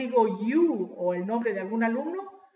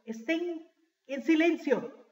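raised pitch, drawn-out classroom order; teacher calling for silence; 6 semitones higher, slower than usual. Acted. Teacher to a noisy class, calling for silence. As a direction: call the class to silence, slowly. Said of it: "Como dice el profesor".

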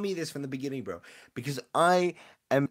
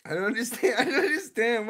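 baffled; 6 semitones higher, 4 dB louder than usual. Real, not acted. Baffled by chat's answer.

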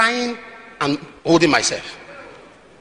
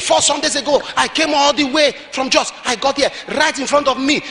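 angry complaint; 8 semitones higher than usual. Retold or imitated. Retold lady's complaint building up.

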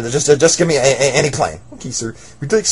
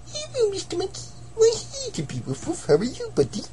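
much higher, soft cartoon voice; squeaky high character voice; 8 dB quieter than usual. New customer cheerfully greeting Mister Monkey, high and soft.

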